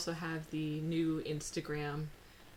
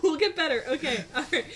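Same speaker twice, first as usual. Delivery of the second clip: high and loud; 8 semitones higher, 9 dB louder, faster than usual. Real, not acted. Mock consoled after laughing fit.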